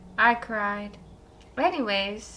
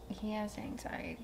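hushed, breathy aside; whispering; 2 semitones lower, 15 dB quieter than usual. Real, not acted. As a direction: whisper it like a secret. Joking aside about the singer.

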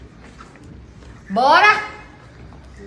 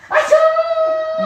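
sudden high peekaboo cry; excited peekaboo; 13 semitones higher than usual. Real, not acted. Peekaboo game with baby.

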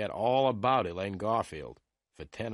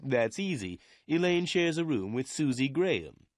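lightly voiced office boss, higher pitch; helpful lawyer; 5 semitones higher than usual. A narrator's reading. Lawyer Meyer tells the detective who shares Elaine's room, helpful. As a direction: answer readily in a raised character voice.

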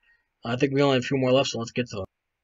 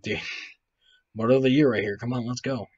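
normal talking voice

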